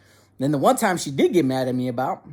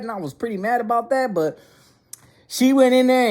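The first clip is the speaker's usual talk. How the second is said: usual speaking voice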